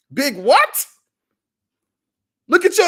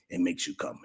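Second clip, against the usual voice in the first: whispering; 10 dB quieter than usual. Real, not acted. Dropped to near whisper.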